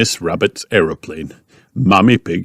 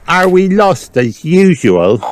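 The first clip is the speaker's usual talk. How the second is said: put-on high, slow child question; high-pitched storybook piglet; Peppa; 10 semitones higher, 3 dB louder than usual. A narrator's reading. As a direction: ask pointedly as a little girl. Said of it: "asked Peppa".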